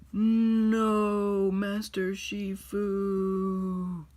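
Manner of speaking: hammed-up grief cry of a kid playing Po; anguished cry. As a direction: wail over the fallen master. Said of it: "And he's like".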